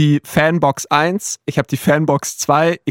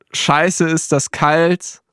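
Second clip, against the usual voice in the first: slow dramatic lyric; much slower than usual. Retold or imitated. Quoting a song lyric.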